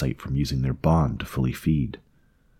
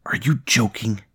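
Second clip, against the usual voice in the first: higher-pitched hissed disbelief; incredulous hiss; 5 semitones higher than usual. A narrator's reading. Host to his parasite, afraid of being seen, hissing. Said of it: "he hissed".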